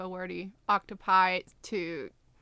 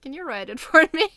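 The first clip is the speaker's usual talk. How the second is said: pleading through a laugh, louder; laughing plea; 5 semitones higher, 9 dB louder, much faster than usual. Retold or imitated. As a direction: beg cheekily while laughing. Asking friend to write for her.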